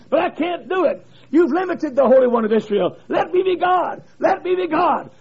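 lifted, rising plea; God begging to be let loose; a pleading divine voice. Acted. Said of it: "God says".

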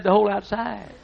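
lightly voiced farmer deadpan; Wood's deadpan answer. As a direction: deliver it as the obvious truth. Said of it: "he talked like this".

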